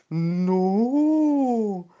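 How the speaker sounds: sehr hohes, lautes Nein einer Kuh; Kuhstimme. Acted. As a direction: ruf hoch hinauf als Kuh. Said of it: "Y le dijo".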